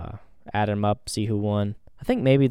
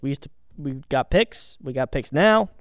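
ordinary delivery

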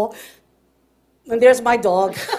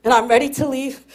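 ordinary talk, nothing marked

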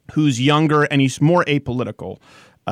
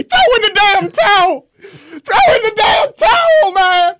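shouted high, dragged out slowly; high, loud, slow shouted movie quote; Rocky Four quote; 6 dB louder, slower than usual. Retold or imitated. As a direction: yell it again as the Rocky Four line, stretched. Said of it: "it was a Rocky Four reference".

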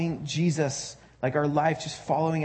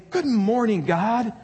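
higher and a bit brighter, mock-cheerful; perky morning greeting; 4 semitones higher, 4 dB louder, slower than usual. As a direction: lightly mimic the peppy spiritual people. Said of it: "and they, they say".

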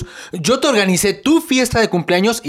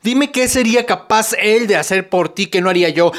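ordinary delivery

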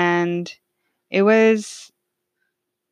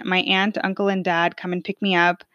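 ordinary talk, nothing marked

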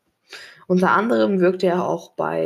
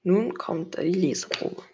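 plain reading voice

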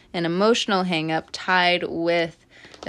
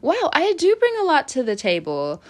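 amazed realization; 6 semitones higher than usual. Retold or imitated. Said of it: "you're going to see coming up".

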